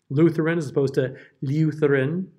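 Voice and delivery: Lutheran as looth-ran, then lyooth-ran; first Lutheran plain, second prissy with y glide; Lutheran without and with ya. Shown as a demonstration. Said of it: "prissy old lady in the church basement".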